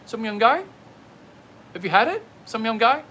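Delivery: put-on half-hearted Chinese accent joke voice; mock Chinese accent. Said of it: "throw in the chinky accent".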